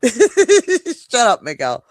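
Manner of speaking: loud teasing laugh; playful shout. Real, not acted. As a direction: laugh and yell it.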